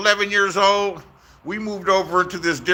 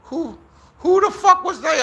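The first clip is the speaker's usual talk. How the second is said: startled question; 11 semitones higher than usual. Retold or imitated. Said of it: "I was like".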